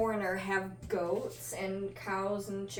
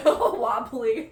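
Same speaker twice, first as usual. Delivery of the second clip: laughing through the words; words broken by laughter; giggling description; 8 dB louder, slower than usual. Real, not acted.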